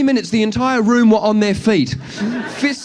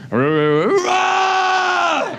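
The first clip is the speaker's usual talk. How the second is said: rowdy growl rising to high roar; swelling rowdy yell; drunken crowd roar; 12 semitones higher than usual. Acted.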